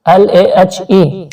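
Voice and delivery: usual speaking voice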